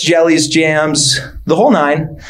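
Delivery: ordinary talk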